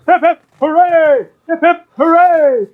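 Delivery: high, drawn-out hooray; crowd cheer. Acted. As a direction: cheer like a tavern crowd hoisting a hero. Bar crowd cheers the drinker.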